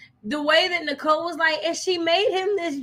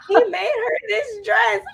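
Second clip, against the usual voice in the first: laughing shout; 8 semitones higher, 3 dB louder than usual. Retold or imitated. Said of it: "when she was like".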